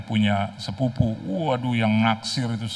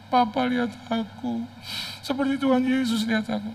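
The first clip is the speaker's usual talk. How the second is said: put-on little girl voice; 13 semitones higher than usual. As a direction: coo it like a small girl. Daughter voice again.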